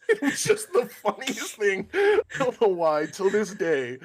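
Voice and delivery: high and loud, laughing through the words; laughing hard. Real, not acted. Host cracking up at his own story, laughing hard. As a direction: let the laugh break your voice.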